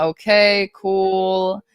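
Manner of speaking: ordinary talk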